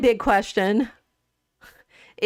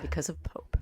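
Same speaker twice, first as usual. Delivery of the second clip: near-whispered, low echo; very soft, under the breath; hushed confirmation; 3 semitones lower, 11 dB quieter than usual. Real, not acted. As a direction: drop it almost to a whisper. Echoes answer under breath.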